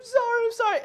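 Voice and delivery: high, teary repeated apology; crying child. Retold or imitated. Father imitating crying daughter apologizing. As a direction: sob it out like a little girl. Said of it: "she's crying, crying".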